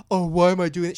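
loud, scornful comeback acted out; imitating a combative snap-back; mock indignant retort. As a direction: throw the question back loudly. Acting Farrah's retort when challenged.

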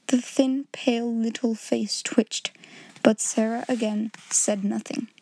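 ordinary delivery

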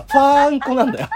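loud laughing punchline; laughing shout. Retold or imitated. Laughing shout of horn name.

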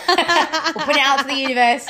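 laughing excitedly. Real, not acted. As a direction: giggle it out loud.

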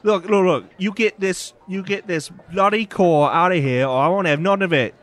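loud, high gruff Cockney barman shouting; loud angry barkeep; gruff bartender. Acted. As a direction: bark at them like a grumpy publican. Bartender character voice.

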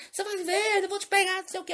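mock angry threat. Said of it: "ficou com raiva".

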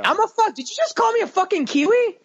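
high-pitched, incredulous mock outrage; mock offence. Scene: accent called Kiwi, reacts with mock offence. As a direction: squawk back as if insulted.

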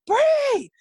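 high, loud imitated call-out; yelling. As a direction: holler a name to get attention. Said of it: "just yelled at the top of his lungs".

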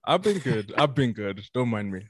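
talking through a snack; words round a mouthful; chewing while talking. Real, not acted. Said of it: "I'm munching on the side".

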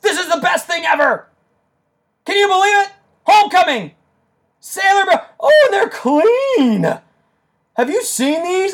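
very high, ecstatic shouting; real, unrestrained screams of delight; excited screaming. Real, not acted. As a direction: shout it like a fan who just got the best news. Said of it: "I'm screaming into the microphone".

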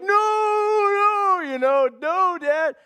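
put-on high, loud childish protest; whiny little boy. Son refusing to let dad pull tooth, high whiny no. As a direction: squeal it like a scared six-year-old. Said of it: "he's like".

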